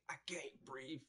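a light imitation of a man gasping that he can't breathe; lightly voiced victim pleading on camera; choking man. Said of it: "when the man say".

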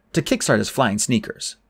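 ordinary delivery

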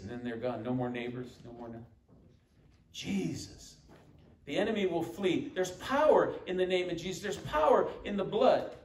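ordinary talk, nothing marked

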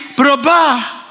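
drawn-out answer falling at the end; angling for a favour. Acted. Wife hoping for a new bag, agreeing with an angle. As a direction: say it as if buttering someone up. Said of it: "Atau gini".

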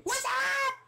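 high, loud squealed greeting; mock attack shout. Player ambushing an Enderman with a potion, high yell.

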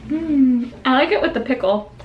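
ordinary delivery

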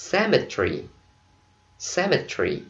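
British "Cemetery" dropping the middle vowel; British pronunciation. Shown as a demonstration. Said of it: "đọc theo kiểu giọng Anh nè".

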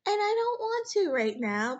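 fighting tears while praising; holding back tears. Real, not acted. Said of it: "I feel tears welling up".

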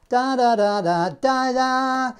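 loose flapped da syllables; flapped tonguing. Shown as a demonstration. Teacher demos tongue flap on the das. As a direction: let the tongue flap lightly. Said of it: "Sort of flap your tongue at it".

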